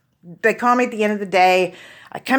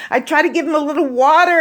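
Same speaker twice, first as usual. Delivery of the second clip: ordinary talk, nothing marked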